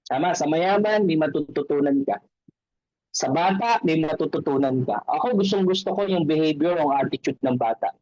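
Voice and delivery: usual speaking voice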